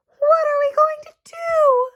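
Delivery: high, distressed mother's cry. A narrator's reading. Mother crying out over her daughter, high and distressed. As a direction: wail it like a frantic mother. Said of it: "cried Missus Cream".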